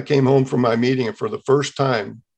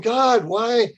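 high, pleading cry of why; desperate plea, voice raised high; pleading with God; 8 semitones higher than usual. Retold or imitated. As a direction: beg God for an answer. Crying out to God in despair.

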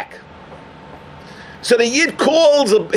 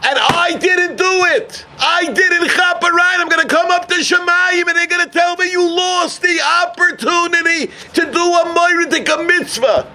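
higher, fervent preaching cry of regret; impassioned preaching; 6 semitones higher than usual. Acted. Preacher voices the driver lamenting his lost mitzvah to the rabbi, fervent raised preaching. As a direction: preach it like a lost chance that burns.

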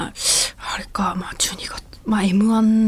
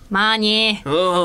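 very slow, louder drawn-out catchphrase; impression voice; 3 dB louder, much slower than usual. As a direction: stretch the word out in a put-on voice. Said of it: "すごく似てる".

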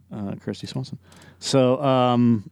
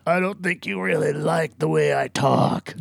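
slow, higher gravelly growl; lightly mocking Clint's gravel; gravelly Clint Eastwood voice; 5 semitones higher than usual. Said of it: "just stressing that voice".

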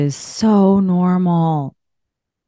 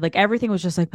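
ordinary delivery

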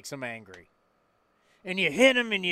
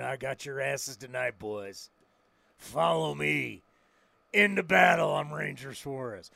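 much lower, softer gunfighter voice; low menacing gunslinger; 5 semitones lower, 4 dB quieter than usual. Pretend. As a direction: growl it like an old Western gunfighter. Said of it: "I picture him as Ranger Suarez".

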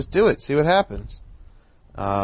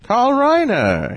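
slow Carl Reiner voice; 14 semitones higher, 7 dB louder, much slower than usual. Retold or imitated. Old man voiced in phone story.

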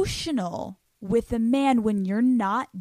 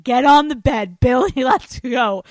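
mock commanding; 4 semitones higher, 9 dB louder than usual. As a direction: bark the order playfully. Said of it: "being like".

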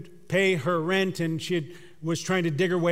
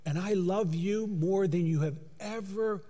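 tender promise; 5 dB quieter, slower than usual. Pretend. Jesus assuring the listener of his love, slow and tender. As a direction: let it fade, very gently. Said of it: "Jesus would say".

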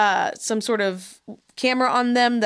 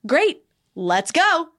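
mock eager; 7 semitones higher, much slower than usual. Eager ghost hunters mocked.

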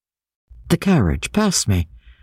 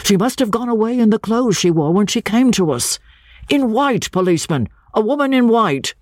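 higher-pitched, insistent correction; interposing second man; 8 semitones higher than usual. A narrator's reading. Second man correcting the description for a policeman, interposing. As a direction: press the correction urgently, voice raised in pitch. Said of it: "interposed the second man".

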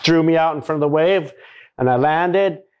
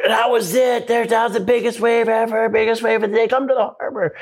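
higher-pitched impression of a woman on the radio; imitated radio call; 8 semitones higher than usual. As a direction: relay excited news, imitating his wife. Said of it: "Nicole gets on the radio".

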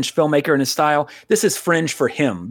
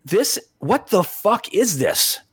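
higher, slow, drawn-out bafflement; baffled disbelief; 6 semitones higher, much slower than usual. Retold or imitated. Fan recalling a strange opening on TV, baffled. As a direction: act out being utterly thrown. Retelling his first baffled viewing.

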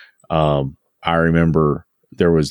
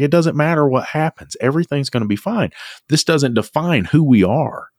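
a lightly voiced dad, higher and unhurried, soothing his son; calm reassuring dad; 6 semitones higher than usual. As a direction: play a father calmly telling his worried son it'll be fine. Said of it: "And he's like".

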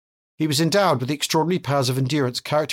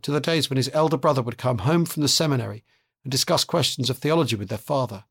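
usual speaking voice

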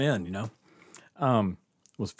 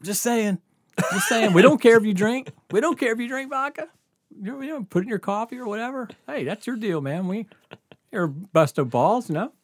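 put-on high, cocky tough-kid threat; mock gangster; 9 semitones higher, 4 dB louder than usual. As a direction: play the little wiseguy making an offer. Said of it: "Like, like gangsters".

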